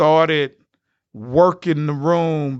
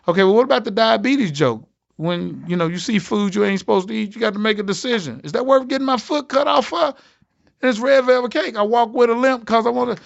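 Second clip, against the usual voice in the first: eager arguing; 8 semitones higher than usual. Retold or imitated. Arguing for his diabetes joke.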